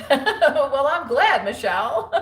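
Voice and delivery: high, loud laughing reply. Real, not acted. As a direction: laugh through the words. Laughs while answering a viewer.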